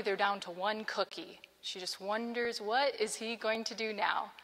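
ordinary talk, nothing marked

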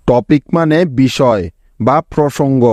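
ordinary talk, nothing marked

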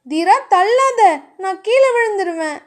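high-pitched pleading not to be pushed; alarmed protest. A narrator's reading. Woman being pushed off the bed, alarmed.

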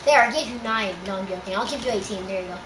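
softly teasing a friend over ammo; murmured joke; low teasing mutter. Real, not acted. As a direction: drop low and quiet, half to yourself.

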